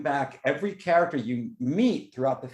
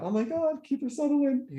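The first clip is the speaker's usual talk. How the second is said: much higher, starstruck gasp; awed fan voice; 14 semitones higher than usual. Retold or imitated. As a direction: gush over the actor like a fan. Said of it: "I thought".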